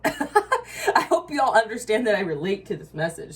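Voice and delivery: loud laughing admission. Real, not acted. Admitting her anger to viewers, laughing. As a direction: laugh through it.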